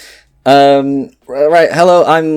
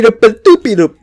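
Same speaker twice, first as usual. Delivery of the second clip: silly singsong noise; 13 semitones higher, 6 dB louder, much faster than usual. Real, not acted. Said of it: "these noises are crazy".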